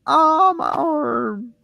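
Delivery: very high, slow, stretched wail of pain; hammed-up agony; mock pain wail. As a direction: drag out the cry like the arm just broke. Victim cries out in pain.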